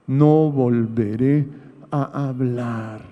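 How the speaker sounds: drawn-out, each phrase weighed slowly; slow solemn scripture quote. Retold or imitated. Preacher reading Job's vow to the congregation, slow and solemn. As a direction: read Job's vow slowly, like a sacred promise. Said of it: "¿Qué le parece?".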